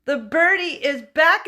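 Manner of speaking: loud, excited announcement. Real, not acted. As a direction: call out the news happily.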